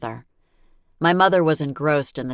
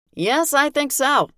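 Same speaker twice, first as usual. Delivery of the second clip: father's voice; 12 semitones higher, 5 dB louder, slower than usual. Acted. Father answers the chief.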